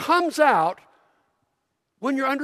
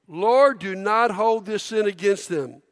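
usual speaking voice

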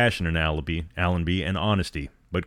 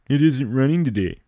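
higher, louder mocking imitation of Corey; mocking echo; 5 semitones higher, 5 dB louder than usual. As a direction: parrot his words back with a sneer. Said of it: "Adams laughed".

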